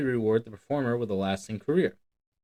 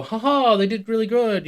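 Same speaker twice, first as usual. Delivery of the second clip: higher, louder delighted spectator chuckling; impressed audience member; 9 semitones higher, 6 dB louder than usual. Retold or imitated. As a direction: play a charmed viewer who laughs and praises. Audience member reacting to a trick.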